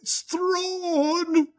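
very high, drawn-out tearful squeal; mock ugly crying. Fan imitating an Australian YouTuber spotting Thrawn, mock ugly crying. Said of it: "this guy starts ugly crying".

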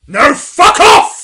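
high, drawn-out explosive yell; mock furious shout. Host acting out the sketch's punchline, mock furious shout. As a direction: erupt in full-volume comic outrage.

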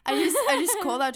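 loud, quick call across the room. Retold or imitated. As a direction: raise your voice and hurry it out. Calling out to shush noisy group.

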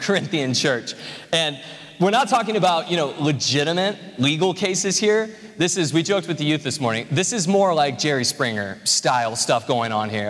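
usual speaking voice

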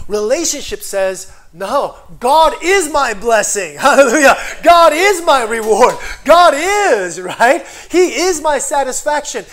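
hallelujah, full-voice preaching; preaching at full force. Acted. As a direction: lift the congregation up. Preaching climax.

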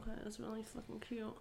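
hushed, seething sarcasm; retold anger kept under the breath; quietly mad. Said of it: "I was already so fucking mad".

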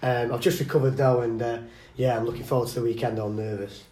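groggy, hungover morning voice; rough after a big night. Real, not acted. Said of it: "I'm very hungover today".

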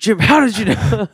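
loud, high-pitched pretend dad; hammed-up parental alarm; mock caught dad. Plays the caught dad, joking.

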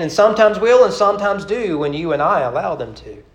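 rhythmic, lilting poem read aloud. Acted. Preacher reciting a rhyming poem to his congregation, lilting. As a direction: recite the rhyme with a storyteller's swing.

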